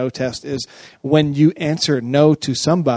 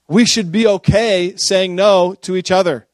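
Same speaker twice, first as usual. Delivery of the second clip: slow, weighty, louder insistence; emphatic statement; 5 semitones higher, 6 dB louder, slower than usual. Real, not acted. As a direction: land the point slowly. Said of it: "emphatic slow point".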